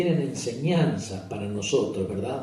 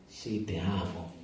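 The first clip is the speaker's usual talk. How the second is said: much softer and lower, barely voiced; humble quiet reply; 4 semitones lower, 9 dB quieter than usual. Peter answers his Lord, soft and humble. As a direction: answer humbly under your breath.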